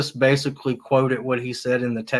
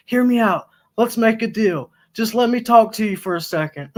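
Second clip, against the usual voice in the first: higher, louder bargaining plea; mock pleading; 7 semitones higher, 5 dB louder than usual. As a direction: plead to make a deal with the police. Pleading with police.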